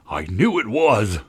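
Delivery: slow, strained outburst; anguished, drawn-out cry; angry cry. A narrator's reading. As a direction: wail in furious vindication. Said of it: "cried Tweedledum".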